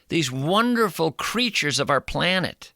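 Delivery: ordinary talk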